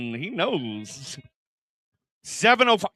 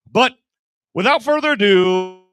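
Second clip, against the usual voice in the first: loud announcer build-up; loud emcee hype; announcer intro; 4 semitones higher, 10 dB louder than usual. Real, not acted. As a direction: sell the intro to the crowd. Host introducing next comic.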